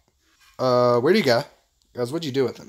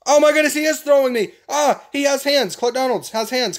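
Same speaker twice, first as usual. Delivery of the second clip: high, raised gamer exclamation; alarmed excitement; 8 semitones higher, 3 dB louder than usual. Real, not acted. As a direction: react wildly to a sudden attack. Thrown by the enemy.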